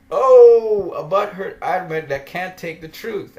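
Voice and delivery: a sudden loud \"Oh\", then \"butthurt\" rising in scorn; jeering at a sulky admin; mocking taunt. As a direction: taunt him with a loud gleeful oh. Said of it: "And I'm laughing".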